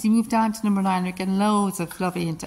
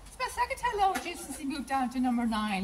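higher, softer voice for the horse; pet talk; 4 semitones higher, 6 dB quieter than usual. Real, not acted. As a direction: coo the line to the horse. Cooing to the horse vs camera.

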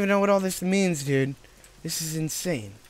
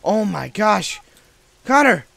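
slow gasping cry, then shouting; being chased; 5 semitones higher, 6 dB louder, slower than usual. Real, not acted. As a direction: gasp and yell for your friend. Sudden threat in horror game.

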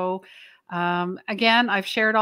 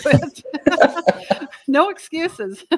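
laughing; 7 semitones higher, 6 dB louder, slower than usual. Real, not acted. Laughing at self about audiobook.

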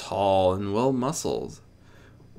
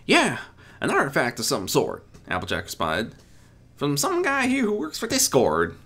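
Applejack voice; 6 semitones higher, 3 dB louder than usual. Acted. Applejack answers.

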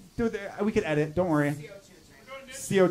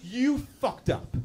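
normal talking voice

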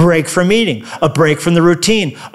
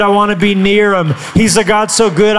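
ordinary delivery

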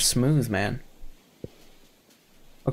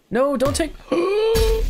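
high-pitched protest breaking into a gasp; dismayed plea; slower than usual. Real, not acted. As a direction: plead in alarm, voice jumping up high. Shell is taken in game.

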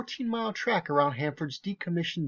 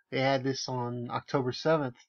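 ordinary delivery

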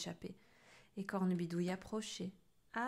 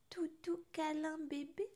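sleepy sigh; 8 semitones higher than usual. Acted. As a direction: murmur like a baby in sleep. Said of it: "soupira Cornebidouille dans son sommeil".

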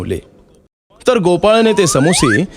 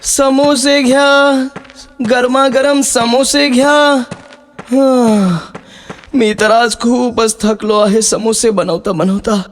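high, slow, weary hawker's call; slow, worn-out street cry; tired voice; 10 semitones higher, slower than usual. Acted. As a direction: drag the call out like an exhausted boy. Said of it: "थकलेल्या आवाजात बोलू लागला".

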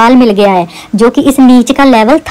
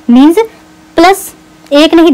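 ordinary delivery

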